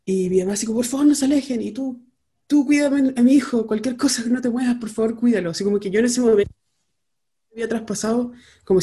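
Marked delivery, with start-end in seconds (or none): 0.8-1.6 mother's plea, high and louder; asustada. Retold or imitated. Imita a mamá asustada. 2.5-5.5 begging someone to guard her son; súplica. Retold or imitated. Encarga al hijo, asustada.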